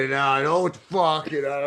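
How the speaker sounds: ordinary talk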